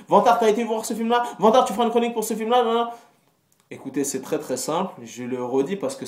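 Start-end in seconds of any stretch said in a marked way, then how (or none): 0.1-2.9 pestering fan voice. Retold or imitated. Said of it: "messages, commentaires, mails".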